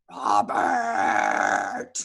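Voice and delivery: low, loud monster bellow; monster roar. Acted. Monster teacher bellows at the boy, low and loud.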